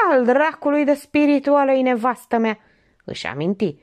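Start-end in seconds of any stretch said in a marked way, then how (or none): 0.1-2.6 husband yelling about his witty wife. A narrator's reading. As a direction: shout it out in exasperation. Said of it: "Romică țipă".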